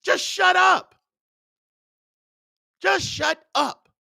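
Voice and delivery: exasperated yell telling him to shut up; angry shout. Retold or imitated. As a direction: bark it at the excuse-maker. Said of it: "I'm like".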